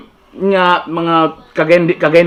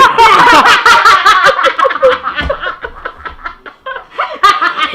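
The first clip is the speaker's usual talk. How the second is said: high-pitched real laughing fit; prolonged high cackling laughter; long helpless laugh. Real, not acted. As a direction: crack up and keep going. Teacher cracks up.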